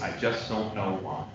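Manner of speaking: quoting him low and softly; low calm voice. Retold or imitated. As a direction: drop to a soft, low, calm voice. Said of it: "Said in a low, calm voice".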